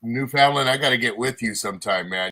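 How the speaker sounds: usual speaking voice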